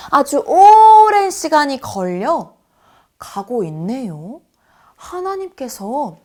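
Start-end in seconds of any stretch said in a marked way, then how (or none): 0.5-1.3 오랜 drawn out long, high and loud; stretched emphasis. Shown as a demonstration. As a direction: stretch 오랜 to show endless time. Showing a very long time. (오랜을 높고 크게 길게 늘여서)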